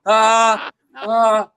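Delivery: loud, high, stuck "ahs"; frozen panicked "ah". Retold or imitated. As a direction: act out a brain jammed on one noise. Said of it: "This is how I sounded".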